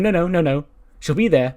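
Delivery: hasty reassurance. Acted. Morgan covering for a friend, hastily reassuring. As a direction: rush to smooth over the refusal.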